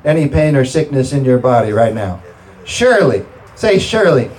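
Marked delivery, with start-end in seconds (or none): 2.6-4.3 high, drawn-out scripture proclamation; emphatic preaching. Real, not acted. Preacher driving healing scripture into the congregation, emphatic preaching. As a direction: proclaim it, stretching the key words.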